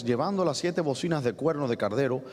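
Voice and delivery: ordinary talk, nothing marked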